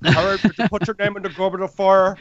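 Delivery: Jamaican Hermione. Acted. Hermione questioning Harry about the goblet, in a Jamaican accent. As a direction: play Hermione as a Jamaican man. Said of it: "Hermione as a Jamaican man would be amazing".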